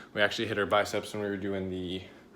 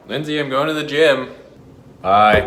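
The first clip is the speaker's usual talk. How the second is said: loud call to another room; 5 semitones higher, 10 dB louder than usual. Real, not acted. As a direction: shout it through the apartment. Calling out to Lindsay at home.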